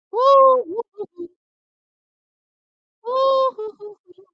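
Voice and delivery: loud, high whoop of joy; whooping cheer. Real, not acted. Fan celebrating the race result, loud high whoop. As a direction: cheer it like your guy just won.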